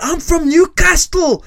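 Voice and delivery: high, loud, slow war cry; hammed-up British street shout; bellowing British challenger. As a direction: roar your hometown as a threat. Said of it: "Og han bare sånn".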